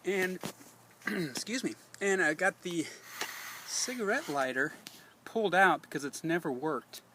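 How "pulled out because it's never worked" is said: normal talking voice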